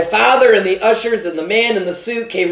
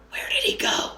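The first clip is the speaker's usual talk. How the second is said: high hushed whisper; urgent whisper in the dark; father whispering his alarm to his son; 10 dB quieter than usual. A narrator's reading.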